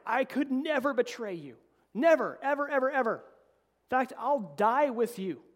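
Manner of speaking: loud, high protest that slows to a drawn-out finish; defiant vow. Retold or imitated. Pastor voicing Peter's protest to Jesus, loud and defiant. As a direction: swear it hotly, never backing down. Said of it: "And Peter's very defiant".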